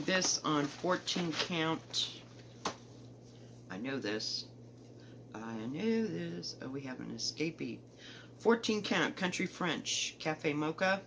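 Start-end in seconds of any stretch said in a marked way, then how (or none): 5.4-6.6 drawn out, slow and a bit softer. Real, not acted. Stitcher to her viewers, slowly drawn out. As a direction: stretch each word slowly, like savouring it. Repeats phrase, second take slower.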